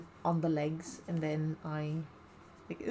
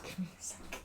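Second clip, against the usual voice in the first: whispering; 10 dB quieter, faster than usual. Real, not acted. Quick aside while fetching scissors.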